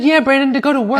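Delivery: high, loud insistent correction; loud reassurance. Retold or imitated. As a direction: shout it over him to set him straight. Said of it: "I said".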